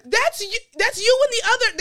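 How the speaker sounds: high, loud exclamation of disbelief; mock exasperation. Comic answers the clueless new neighbors, mock exasperation. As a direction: cry it out at the oblivious culprit.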